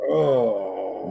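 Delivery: long, low groan of pretend despair; playful moaning dread; mock dismay. Mock dismay at prune juice.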